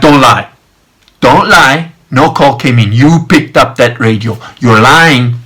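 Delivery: loud, firm accusation; menacing intent. Retold or imitated. Man confronts lying guard over radio, menacing. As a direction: retell confronting a liar with hard, separate sentences. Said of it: "but the intent came out".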